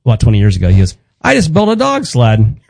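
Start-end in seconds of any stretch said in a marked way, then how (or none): 1.2-2.6 high, slow proud dad voice; imitating dad. Son imitating his dad boasting about the sled, high and slow. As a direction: announce it like a proud achievement.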